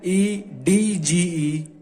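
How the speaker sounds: E D G E spelt letter by letter, very slow; teacher spelling out E D G E slowly. Shown as a demonstration. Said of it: "spelling क्या है".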